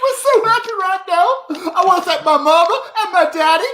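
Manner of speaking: high Southern belle gushing, on the edge of laughter; Southern accent. Acted. Guest thanking mama and daddy, gushing Southern accent. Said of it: "That's a really good Southern accent".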